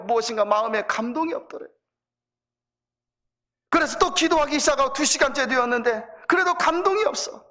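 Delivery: ordinary delivery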